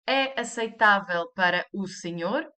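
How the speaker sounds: "É aceitável para o senhor" rising on "senhor"; question intonation. Shown as a demonstration. Teacher asking extra formally, rising tune on "senhor". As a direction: ask it by lifting the end of "senhor". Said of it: "You just raise the intonation".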